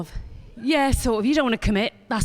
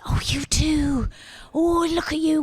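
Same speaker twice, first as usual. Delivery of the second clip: whisper; 2 semitones higher than usual. Pretend. Spotting a lone non-local.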